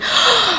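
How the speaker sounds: sharp high gasp of panic; panicked gasp. Retold or imitated. Retelling the planner's panic, a quick gasp. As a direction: gasp as if caught out. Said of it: "she kind of looks very panicked".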